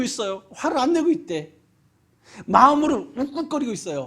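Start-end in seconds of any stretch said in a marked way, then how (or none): 0.5-1.5 mock disbelief. Mocking angry people's denial.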